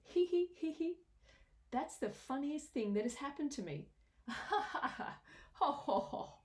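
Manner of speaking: high giggles into ho-ho laughter; giggling. A narrator's reading. Elephant laughs at the funniest thing, high giggling. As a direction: giggle then guffaw. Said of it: "He began to giggle".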